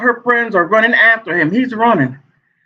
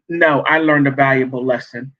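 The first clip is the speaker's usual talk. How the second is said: ordinary talk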